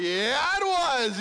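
high, drawn-out excitement; mock giddy dad; delighted agreement. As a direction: echo the kid's thrill. Dad matches son's delight.

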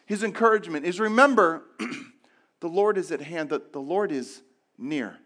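ordinary talk, nothing marked

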